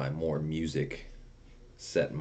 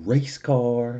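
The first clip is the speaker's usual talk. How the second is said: mock yell; 7 semitones higher, 7 dB louder than usual. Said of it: "we used to yell at the window to people".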